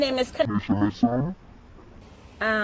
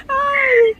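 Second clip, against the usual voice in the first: crying shout; 10 semitones higher than usual. Real, not acted. Bursts out on prank reveal.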